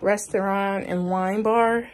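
usual speaking voice

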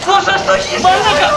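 high, loud frantic yell at the game; yelling in panic. Real, not acted. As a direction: shout it out mid-fight. Frantic yelling while gaming. (έξαλλη κραυγή πανικού)

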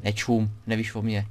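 ordinary talk

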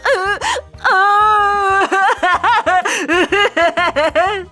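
long, high belly laugh; cackling laughter. Acted. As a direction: laugh on and on, helplessly amused. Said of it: "깔깔 웃었지요". (깔깔대는 긴 웃음소리)

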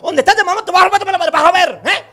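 amenaza a gritos, aguda y fuerte; esposa furiosa. Retold or imitated. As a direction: grita amenazas como mujer celosa. Esposa celosa amenaza al marido. (esposa celosa gritándole al marido)

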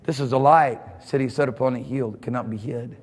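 low, grave drop in pitch; low, weighty aside to the congregation; hushed emphasis. Real, not acted. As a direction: drop the voice right down, solemn. Preacher drops voice for solemn emphasis.

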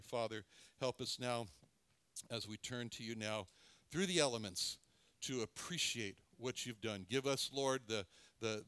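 soft, hushed pastor's prayer; gentle prayer before communion; closing prayer. Acted. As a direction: pray quietly over the congregation.

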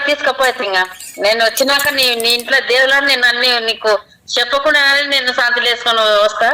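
usual speaking voice

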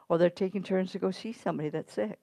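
ordinary talk, nothing marked

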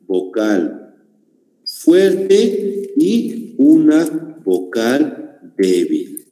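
very slow, word by word, for note-taking; dictating to the class, drawn out; slow dictation. Shown as a demonstration. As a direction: spell it out slowly so they can write. Teacher dictating definition slowly.

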